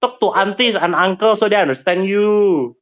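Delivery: high Singlish lecturing; Singlish accent. Pretend. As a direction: scold in a Singlish lilt. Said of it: "Speak Singlish".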